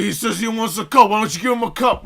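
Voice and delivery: retold father arguing at full volume; imitated yelling dad. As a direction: play the dad demanding an explanation. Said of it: "like, a yelling match at each other".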